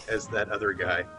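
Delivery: ordinary talk, nothing marked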